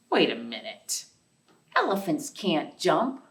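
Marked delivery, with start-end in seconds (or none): none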